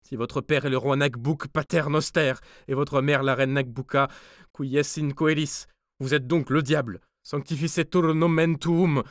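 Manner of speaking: high, loud, panicked stammer mixed with prayer; terrified stammering. A narrator's reading. Guard half-dead with fright faces the devil-dwarf. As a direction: gabble prayers in sheer terror. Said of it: "balbutia-t-il, demi-mort de frayeur".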